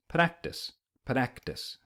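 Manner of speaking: practice with a quick flapped R; practice, tongue tapping the R like a light D. Shown as a demonstration. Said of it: "a P D sound at the beginning".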